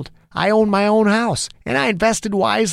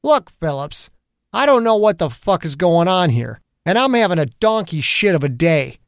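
building heated cop rant, pitched up; angry rant; 6 semitones higher than usual. A narrator's reading. Angry detective venting at the federal agent. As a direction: vent the whole bad day at him.

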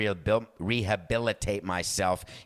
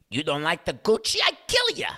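shooting up high in cartoonish Italian accent; bad Italian accent; 7 semitones higher, 4 dB louder than usual. House of Gucci character threatening, hammy Italian accent. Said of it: "Why were we just talking Italian?".

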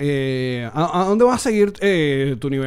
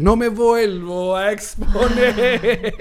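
stretched slow and laughing, a touch higher; drawn-out laughing repeat; 4 semitones higher, much slower than usual. Shown as a demonstration. Stretching out the vow as a joke, laughing slowly. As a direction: drag the vow out while laughing. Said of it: "repeats phrase twice".

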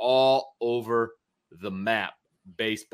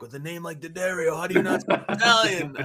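higher, mock disappointed Italian waiter; disappointed old waiter; 7 semitones higher than usual. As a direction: play the let-down old waiter, pitched up. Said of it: "He's like".

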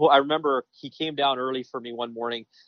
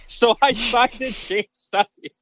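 punchline bursting with laughter, much higher and louder, slow; laughing through it; 10 semitones higher, 5 dB louder, slower than usual. Real, not acted. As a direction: laugh out the punchline at your own story. Punchline of his own story.

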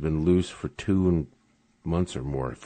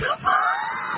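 cracking up at the college joke; high burst of laughter. Real, not acted.

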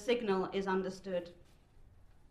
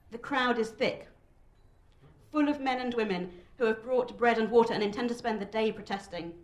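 ordinary delivery

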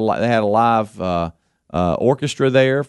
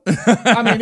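ordinary delivery

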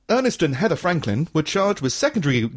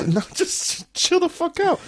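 mock exasperated retort; slower than usual. Mock retort, pitch jump.

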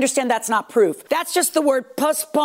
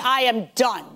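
ordinary delivery

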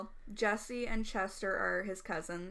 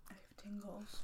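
soft ASMR whisper; 2 semitones lower, 13 dB quieter than usual. Pretend.

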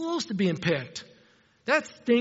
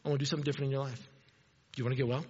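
gentle invitation; 6 semitones lower, 6 dB quieter, much faster than usual. Retold or imitated. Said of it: "he picks us and says".

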